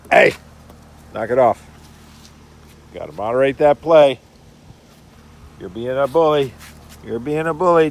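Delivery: firm, loud reprimand; stern and loud to break up the fight; loud scolding. Real, not acted. Owner scolding the dog bullying the puppy, loud and stern. Scolding rough-playing dogs.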